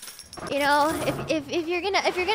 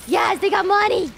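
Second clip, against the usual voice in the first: loud, higher-pitched delight at loot; thrilled, raised-voice exclamation; excited cheer; 4 semitones higher, 5 dB louder than usual. Real, not acted. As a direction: celebrate the find out loud. Finds money on game bodies.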